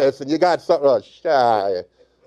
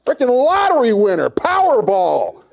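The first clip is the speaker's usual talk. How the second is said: mock incredulous yelling; 7 semitones higher, 5 dB louder than usual. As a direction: yell it in envious disbelief. Mock rant at lucky boys.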